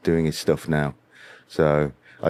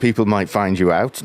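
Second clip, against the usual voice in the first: ordinary talk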